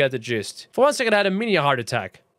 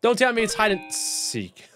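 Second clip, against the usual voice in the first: normal talking voice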